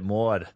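"Maud" pitched low, as the Brooklyn driver said it; deep imitated Brooklyn "Maud"; his way of saying Maud. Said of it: "That's how he said it".

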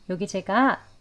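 normal talking voice

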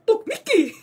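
very high, loud cartoon mouse voice; Mickey impression. ミッキーのモノマネ、とても高く大声で. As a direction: chirp it like Mickey. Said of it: "ミッキーマウス".

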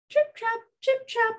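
tiny high-pitched trip-trap; high, dainty hoof sounds; small hooves. Acted. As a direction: tap out little hoofbeats in a high voice. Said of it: "had small hooves, and it went like this".

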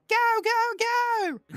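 very high, loud hammed-up British yelps; squawked British parody; mock British voice. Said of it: "what kind of thing I sound like".

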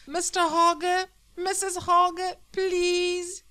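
high, loud begging through the wall; pleading cry. Acted. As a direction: plead with them desperately. Boy begs neighbours to stop.

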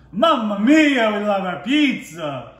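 hammed-up Italian pizza chef, slow and high; Italian accent. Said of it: "the best impression I could right now".

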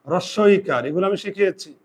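usual speaking voice

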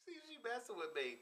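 laughing, high and loud through the words; can't get the line out for laughing. Real, not acted. Cast member cracking up while suggesting a line, laughing.

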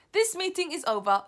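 high, loud dismissal; commanding announcement. Acted. Grand High Witch closing the witches' meeting, loudly commanding. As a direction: call the meeting closed at full voice.

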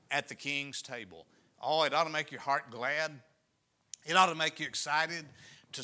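rising, fervent sermon voice; impassioned pulpit appeal; preaching. Acted. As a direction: stir the congregation's hearts. Preaches to the congregation.

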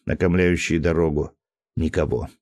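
ordinary delivery

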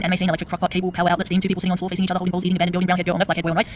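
rattled off very fast; rapid prompt reading. Shown as a demonstration. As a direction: rush through the list in one breath.

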